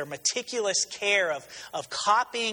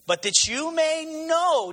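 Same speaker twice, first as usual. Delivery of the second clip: raised, slowed proclamation; Jesus' declaration; 9 semitones higher than usual. Acted. Jesus answering the scribes, a raised solemn proclamation. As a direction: announce it with authority. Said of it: "Jesus says".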